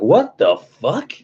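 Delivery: high, drawn-out, mock shocked "what"; retold surprise. Telling viewers how he found his sticker, high slow mock shock. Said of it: "I was like".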